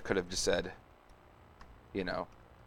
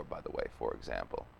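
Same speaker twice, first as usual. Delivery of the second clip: 1 quiet aside; 12 semitones lower, 10 dB quieter than usual. Real, not acted. Muttered aside about e.g.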